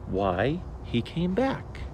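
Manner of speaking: usual speaking voice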